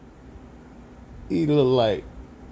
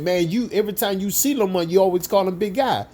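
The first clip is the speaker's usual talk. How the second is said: raised high, exasperated; 9 semitones higher than usual. Retold or imitated. Coworker protests the nickname, high and exasperated. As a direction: whine it up high in frustration.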